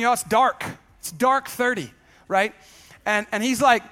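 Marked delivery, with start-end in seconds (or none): none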